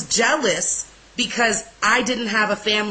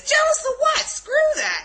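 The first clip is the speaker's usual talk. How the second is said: very high-pitched incredulous squawk; hammed-up indignant disbelief; outraged. Speaker retelling her retort to the Spirit, outraged. Retold outraged reply to accusation.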